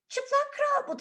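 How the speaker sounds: put-on child calling out. Child calls out to the crowd: high, loud cry. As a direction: point and call out like a small child.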